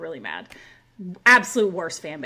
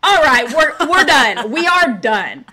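much louder, higher final word; retold fed-up outburst; 5 semitones higher, 11 dB louder, much slower than usual. Threatened woman ends the quarrel, loud and fed-up. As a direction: re-enact shutting it down, each word hit hard.

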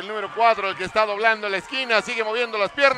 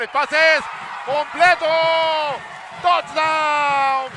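higher, louder excited call; touchdown call; 6 semitones higher, 4 dB louder, slower than usual. Real, not acted. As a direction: lift the voice for the score. Touchdown call in live broadcast.

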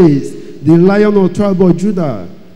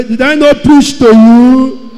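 louder, higher challenge; bold reproach; 5 semitones higher, 5 dB louder than usual. Retold or imitated. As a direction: confront the sinner boldly. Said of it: "Pastor will ask you with boldness".

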